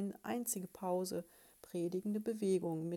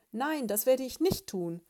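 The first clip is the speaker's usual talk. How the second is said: loud, higher outburst of a fed-up husband; shouting; 5 semitones higher, 9 dB louder than usual. Acted. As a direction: finally snap back at his wife, loud. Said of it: "schrie Balduin Brumsel". (laut schreiend, genervt)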